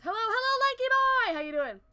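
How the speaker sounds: high, loud cheerful hello; excited greeting. Real, not acted. Streamer loudly greeting a chatter, high and excited. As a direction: greet the donor with a big burst. Reading a chat donation aloud.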